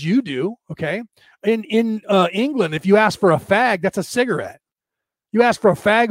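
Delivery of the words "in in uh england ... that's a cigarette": normal talking voice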